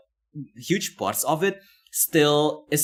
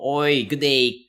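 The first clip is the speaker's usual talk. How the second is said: mock Australian accent; 3 semitones higher, 4 dB louder, slower than usual. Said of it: "I'm gonna practice my accent".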